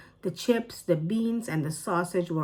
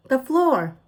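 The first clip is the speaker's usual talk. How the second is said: high, louder exasperated reply; raised, fed-up child answer; 7 semitones higher, 7 dB louder than usual. A narrator's reading. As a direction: snap it out, fed up. Ellie exasperated with grandma.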